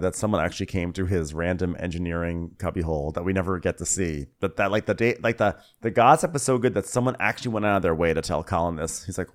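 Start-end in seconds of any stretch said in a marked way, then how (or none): none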